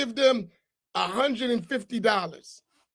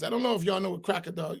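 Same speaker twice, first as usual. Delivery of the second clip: ordinary talk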